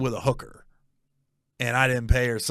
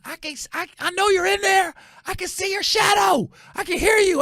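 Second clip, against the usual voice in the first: very high, loud mimicked screech; retold yelling; 4 dB louder than usual. Angry caller yelling at the man hiding inside, screeching. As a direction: imitate a woman screaming through a door. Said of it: "then she starts yelling".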